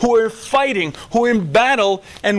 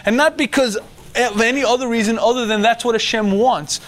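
usual speaking voice

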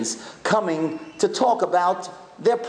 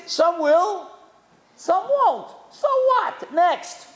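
punchy motto; 12 semitones higher, much slower than usual. Retold or imitated. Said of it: "You know what he said fundraising is?".

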